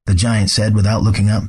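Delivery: ordinary delivery, nothing marked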